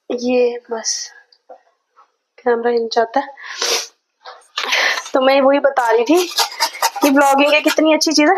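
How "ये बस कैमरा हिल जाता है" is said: whispered aside. Real, not acted. Aside while camera shakes.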